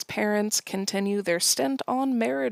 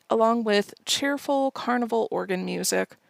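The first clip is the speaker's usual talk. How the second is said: ordinary talk, nothing marked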